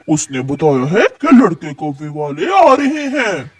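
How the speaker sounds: normal talking voice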